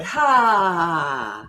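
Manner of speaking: long audible sigh out. Shown as a demonstration. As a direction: breathe out with a sigh. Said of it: "tap and sigh it out".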